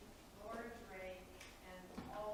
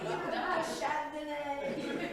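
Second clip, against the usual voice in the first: Deschardonnay slowly said high with a French accent, laughing; 6 semitones higher, 13 dB louder, much slower than usual. Shown as a demonstration. Reading names to the council, a laughing French accent on Deschardonnay. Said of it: "I try to say it with that French accent".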